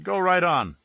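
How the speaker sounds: a hint of a thin, high-voiced man. A narrator's reading. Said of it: "His voice was thin and high".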